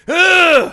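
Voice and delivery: high, loud hammed-up throwing grunt; shouted grunt of a mock robot pilot throwing; mock effort grunt. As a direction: heave out a loud strained yell. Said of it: "he's just like".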